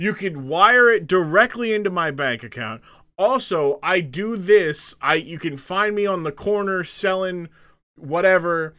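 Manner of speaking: high, loud self-promotion; brash American guest. Acted. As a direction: pitch yourself loudly like an overeager guest. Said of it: "The Americans are like".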